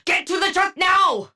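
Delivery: high, shouted order; urgent shout. Acted. To the squad, ordering them to the truck, shouting high. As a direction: bark the order across the field.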